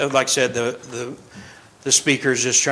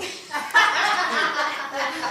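laughing; 12 semitones higher, 6 dB quieter than usual. Real, not acted. Laughing at snowman joke.

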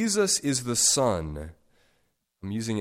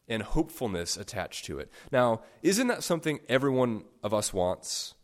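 ordinary talk

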